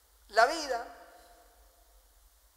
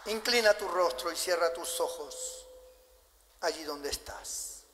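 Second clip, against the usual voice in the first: altar call; 2 semitones lower, 3 dB quieter than usual. Acted. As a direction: invite them gently to bow and reflect.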